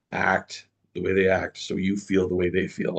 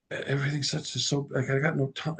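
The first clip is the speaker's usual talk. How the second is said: higher, softer flustered voice; a nervous young woman rambling, higher and softer; flustered employee; 6 semitones higher, 3 dB quieter than usual. Retold or imitated. Employee panicking on the phone to her boss, higher, softer rambling. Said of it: "And she goes".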